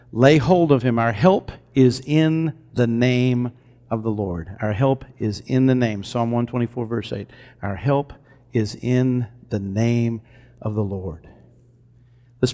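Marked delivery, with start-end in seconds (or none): 1.0-6.0 solemn declaration. Acted. Preacher declaring scripture to congregation, slow and reverent. 7.6-11.3 slow, hushed reading of the verse; soft scripture reading. Acted. Preacher softly reads Psalm verse aloud, hushed and slow.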